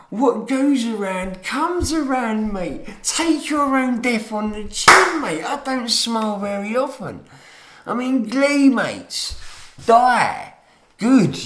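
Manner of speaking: yelled at the top of the voice, high and loud; furious shouting. Real, not acted. Ranting at older people, furious yelling. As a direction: spit the hate out at them.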